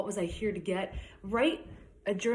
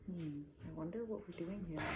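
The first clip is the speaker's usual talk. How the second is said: soft thinking voice; 13 dB quieter than usual. Acted. Voicing Becky's thought.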